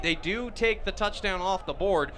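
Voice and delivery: usual speaking voice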